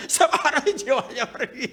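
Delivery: chuckling through the words; laughing. Real, not acted. Preacher laughing at the widower's song to the church. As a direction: laugh while repeating it.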